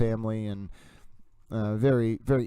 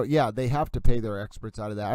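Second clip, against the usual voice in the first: ordinary talk, nothing marked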